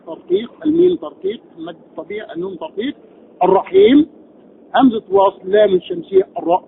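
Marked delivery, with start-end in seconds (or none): none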